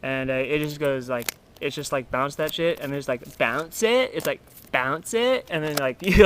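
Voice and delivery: normal talking voice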